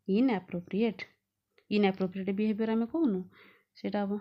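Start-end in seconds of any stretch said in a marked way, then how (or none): none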